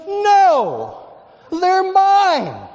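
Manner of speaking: put-on high, loud whiny six-year-old; bratty kid. Boy refusing to share his Skittles, high and loud. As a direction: play the stubborn little boy.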